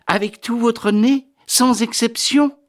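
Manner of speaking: high, eager questioning; insistent duchess. Acted. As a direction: press the question in a high lady's voice. (duchesse insistante, voix haut perchée)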